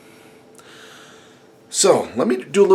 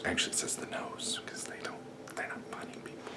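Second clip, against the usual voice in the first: whispered joke aside; 19 dB quieter than usual. Real, not acted. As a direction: breathe it as a secret joke.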